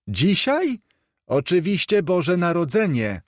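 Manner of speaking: put-on a street boy puzzled by the question; boy's voice. A narrator's reading. Boy in the street answering Scrooge, lightly voiced.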